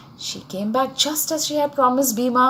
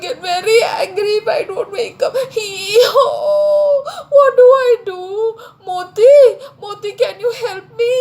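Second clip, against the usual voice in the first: high, loud wailing donkey voice; crying donkey; 10 semitones higher, 6 dB louder than usual. A narrator's reading. Donkey begs his dog friend for help waking up, crying. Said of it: "why are you crying".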